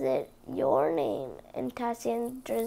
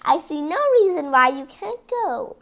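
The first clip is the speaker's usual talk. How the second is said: louder, sly character voice; 3 semitones higher, 9 dB louder than usual. Acted. Stepmother slyly grants permission, louder. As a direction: sound generous but scheming. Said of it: "she said slyly".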